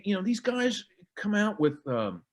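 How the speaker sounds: ordinary talk, nothing marked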